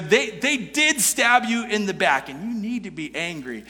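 usual speaking voice

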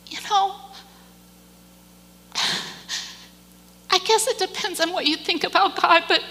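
high, strained, tearful voice; choked up. Real, not acted. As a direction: push the words out through rising emotion. Moved after upsetting story, choked up.

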